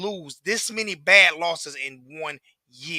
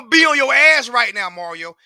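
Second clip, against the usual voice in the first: much louder, higher call-out; real angry call-out; 3 semitones higher, 10 dB louder than usual. Real, not acted. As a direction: yell it at the coach like a threat. Ranting at coach Mario.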